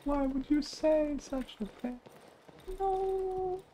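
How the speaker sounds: very high, soft squeaky little voice; squeaky character voice. Pretend. Gamer voicing the sad creature in a squeak. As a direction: plead like a tiny hurt creature.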